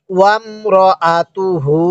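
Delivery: ওয়ামরাআতুহু syllable by syllable, last hu held; measured chant of ওয়ামরাআতুহু with drawn-out ending; slow model recitation. Shown as a demonstration. Teacher asking learners to say along, slow measured ওয়ামরাআতুহু. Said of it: "আমার সাথে বলুন".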